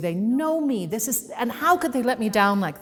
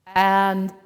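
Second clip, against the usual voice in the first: ordinary talk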